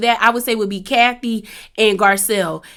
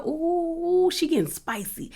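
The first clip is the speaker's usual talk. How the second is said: hushed, softer aside; impressed aside; 6 semitones higher, 9 dB quieter, slower than usual. Retold or imitated. Viewer reacting to Garcelle's comeback, impressed hushed aside. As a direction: drop the volume on a gossipy reaction.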